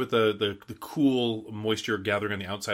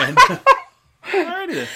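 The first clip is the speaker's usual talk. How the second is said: high, loud laugh through the words; laughing as he talks; 10 dB louder than usual. Real, not acted. Giggling fit after the sneeze.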